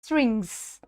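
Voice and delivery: स्ट्रिंग्स said "stringz", with a falling tone; model pronunciation. Shown as a demonstration. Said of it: "का pronunciation स्ट्रिंग्स है".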